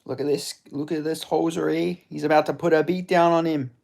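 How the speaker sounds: high mock-Canadian fight talk; Canadian accent. Streamer calls a hoser's coming beatdown, mock Canadian accent. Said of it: "you gotta add, like, hoser and air".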